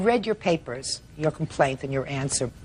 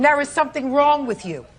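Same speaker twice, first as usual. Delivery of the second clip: raised, incredulous scolding; exasperated judge; 9 semitones higher than usual. Real, not acted. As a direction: let the disbelief ring out. Judge repeats her scolding.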